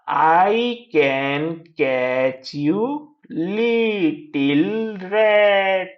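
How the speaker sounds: very slow, every word drawn out; reading out. Shown as a demonstration. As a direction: read syllable by syllable for learners. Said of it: "read out करना है".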